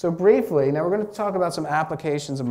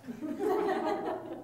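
laugh; 9 dB quieter than usual. Real, not acted. Laughing at own joke.

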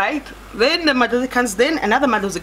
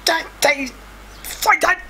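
high-pitched mock protest; retold panic in a high voice; 5 semitones higher than usual. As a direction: squeal out short, panicky refusals.

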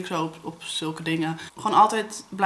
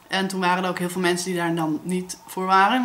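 normal talking voice

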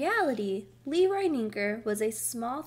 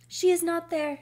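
high, loud, worried report; raised-voice alarm; 7 semitones higher, 7 dB louder than usual. A narrator's reading. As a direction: announce it with rising worry. Wife alarmed, pet still missing.

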